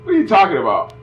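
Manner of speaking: put-on lady in front scoffs at the kid, sassy and loud; scoffing lady. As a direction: brush the kid off like a sassy stranger.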